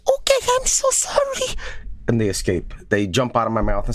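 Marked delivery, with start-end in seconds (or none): 0.1-1.6 very high squeaky bacteria voice; high-pitched pleading little creature; apologetic bacteria. Acted. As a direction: squeal a tiny germ's panicked apology. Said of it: "the bacteria says".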